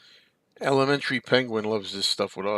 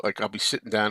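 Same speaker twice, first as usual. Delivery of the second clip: usual speaking voice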